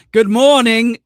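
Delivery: loud, high mock greeting yell; yelling out the window. Streamer mocking the dad yelling greetings out the car window, loud and high. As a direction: bellow it at the passers-by, high and loud. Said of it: "by yelling at everyone outside".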